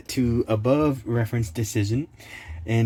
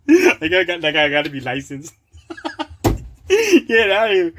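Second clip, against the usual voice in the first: giggling, words breaking into laughter; can't hold back the laugh; real laughter bubbling up mid-rant; laughing through words; 5 semitones higher, 5 dB louder than usual. Real, not acted. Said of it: "Gotta stop laughing".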